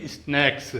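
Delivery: 'is-naiks', the model reading of स्नैक्स; leading 'is' sound. Shown as a demonstration. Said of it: "तो क्या बोलेंगे?".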